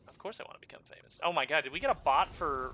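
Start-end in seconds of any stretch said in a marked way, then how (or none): 0.1-1.2 fast, hushed whisper of a joke answer; playful whispered aside; mock whisper. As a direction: breathe it out like a secret. Joking reply to famous question.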